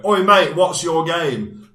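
a bloke picking a fight outside the pub, loud; retold thug. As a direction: imitate the man squaring up to him. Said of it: "coming up to me and going".

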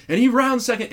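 high, loud thrilled call; excited. Retold or imitated. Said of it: "he's like".

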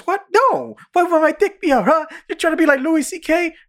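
very high, squeaky protest; mock indignant denial. Retelling the accused man's answer in the quarrel, mock indignant. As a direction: argue back in a comic squeal. Acted back-and-forth quarrel from show.

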